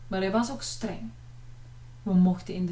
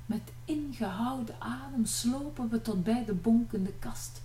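ordinary delivery, nothing marked

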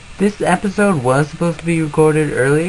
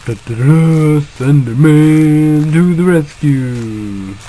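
slow, louder heroic fanfare voice; superhero announcer; 3 semitones higher, 7 dB louder, much slower than usual. Pretend. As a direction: announce the hero's arrival, drawn out. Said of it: "Superhero name, like".

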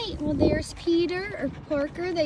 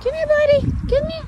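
much higher and louder eager call; eager pet call; 7 dB louder, faster than usual. Real, not acted. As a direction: call him in, all excited. Calling the animal more eagerly.

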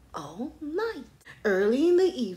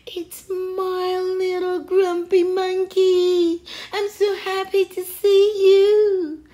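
overjoyed storybook mother; gushing mom cry; 9 semitones higher, 11 dB louder than usual. A narrator's reading. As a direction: greet your child with gushing joy. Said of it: "Jim's mom cried".

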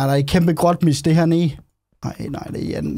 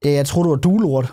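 normal talking voice

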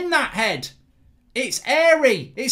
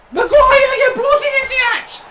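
yelling in real fury; furious shout; 10 semitones higher, 7 dB louder than usual. Real, not acted. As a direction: scream at the player in rage. Furious at own goal.

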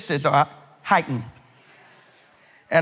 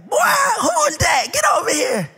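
put-on old grandma. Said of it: "And she was like".

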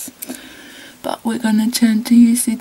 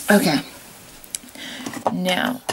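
normal talking voice

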